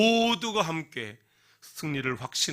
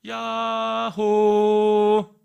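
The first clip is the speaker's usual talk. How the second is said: slow, high, louder sung call from a mountaintop; calling out yaho; 8 semitones higher, 11 dB louder, much slower than usual. Acted. Girl on a mountaintop calling out, slow high sung call. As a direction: call yaho across the valley. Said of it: "산 꼭대기 올라갔어요".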